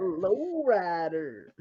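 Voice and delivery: slow, drawn-out name call; lightly mock ring announcer; echoed announcer call. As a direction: stretch the name like an arena intro.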